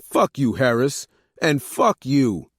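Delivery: loud angry outburst; yelling. A narrator's reading. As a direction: spit it out at him. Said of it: "Vegas yelled at Orlando".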